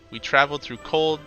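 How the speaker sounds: usual speaking voice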